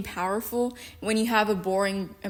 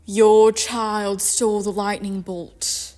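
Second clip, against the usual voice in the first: mock-regal British accent; 4 dB louder than usual. Recapper voices Eddard threatening Poseidon, in grand British accent. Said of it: "they always cast someone with a British accent".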